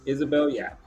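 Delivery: ordinary talk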